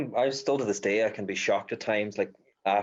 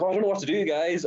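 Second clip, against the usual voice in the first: higher, faking helplessness for followers; mock helpless; 8 semitones higher, faster than usual. Filming an Instagram story for followers, mock helpless. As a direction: put on a helpless act for the camera. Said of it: "but I was like".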